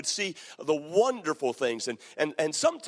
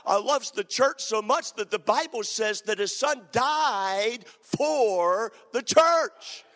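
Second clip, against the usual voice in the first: rising preaching climax; 5 semitones higher than usual. Acted. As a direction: build the call to the vineyard like a crescendo. Building sermon climax.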